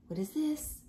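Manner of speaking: ordinary delivery, nothing marked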